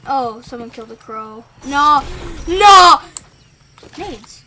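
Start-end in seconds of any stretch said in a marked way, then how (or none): 1.7-3.0 loud "no", building louder; protesting shout. Real, not acted. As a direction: shout it in alarm, harder each time. Protests a setback in the game.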